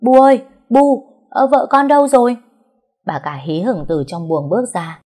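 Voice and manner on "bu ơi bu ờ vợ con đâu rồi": high, loud call across the yard; calling out loudly. A narrator's reading. Young husband calling for his mother, loud and high. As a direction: shout for his mother from outside. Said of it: "thì gọi lớn".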